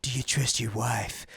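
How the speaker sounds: low, slow, quiet movie-character line; hushed, deliberate film impression; Tim Robbins. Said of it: "Tim Robbins is like".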